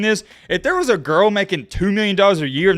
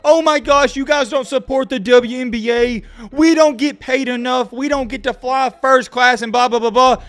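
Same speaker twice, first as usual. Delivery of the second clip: high, whining mock complaint; mocking whiny woman; 7 semitones higher than usual. Vlogger mocks complaining women, high nasal-free whine. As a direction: sneer at them in a squeaky whine. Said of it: "all these females talking about".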